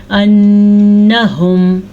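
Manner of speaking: अन्नहुम with nasal held nun; ghunna. Shown as a demonstration. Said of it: "इसे कहते हैं गुन्ना". (अन्नहुम, गुन्ना के साथ)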